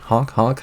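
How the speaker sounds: voiced clown horn honks, light and playful. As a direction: honk like a little clown horn. Clown honks before the kill.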